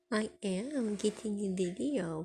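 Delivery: usual speaking voice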